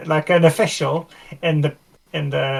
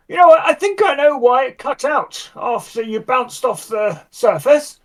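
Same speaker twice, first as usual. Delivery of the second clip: lightly voiced tinkerer, pitched higher; higher-pitched, gently explaining character voice; eager helpful mechanic; 7 semitones higher than usual. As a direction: offer earnest technical advice in a higher voice. Player voices Spanners giving mechanical advice.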